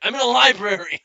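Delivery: loud, high blurted reply; mock loud protest. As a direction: blurt it far too loudly for a library. Acts loud reply to whisper question.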